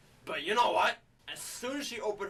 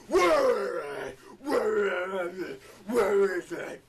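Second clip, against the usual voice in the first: hammed-up, garbled Batman shout; Batman growl; 5 dB louder, much slower than usual. Punching a criminal as Batman, garbled mock roar. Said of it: "here's how bad he, his, he is when he's Batman".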